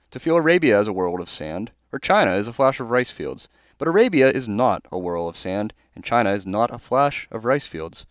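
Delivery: formal reading of a book passage; reading a passage aloud. Acted. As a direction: deliver the quote with conviction. Reads a Chesterton passage.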